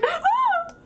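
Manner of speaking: high squeal of fright; imitated scream. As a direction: squeak like a startled cat. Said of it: "I love the, like, meow, and I was like".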